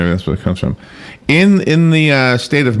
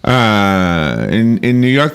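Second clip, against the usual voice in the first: ordinary talk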